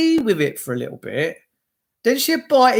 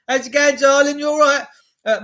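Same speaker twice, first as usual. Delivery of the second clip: normal talking voice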